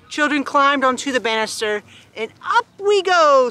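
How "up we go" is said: high, slow, drawn-out cheer; read-aloud squeal. A narrator's reading. Petunia climbing on the banister, squealing high. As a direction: stretch it out like an eager squeal. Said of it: "squealed Petunia".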